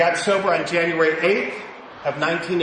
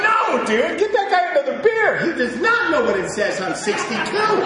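laughing mock reply; 10 semitones higher, 3 dB louder than usual. Mocking reply, laughing high.

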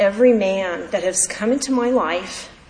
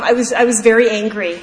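usual speaking voice

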